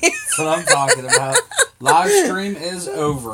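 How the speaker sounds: high laughing shout. Real, not acted. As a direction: blurt it out through laughter. Laughing retort.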